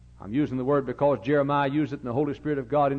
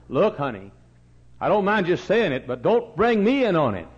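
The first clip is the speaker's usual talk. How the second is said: higher and louder, telling her off firmly; raised retort; 7 semitones higher, 9 dB louder than usual. Retold or imitated. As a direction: push back, loud and high, keep me out of it. Preacher retells his reply.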